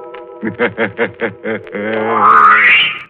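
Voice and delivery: long sinister host cackle; evil cackle. Acted. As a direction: cackle like a gleeful ghoul. Host's drawn-out evil laugh.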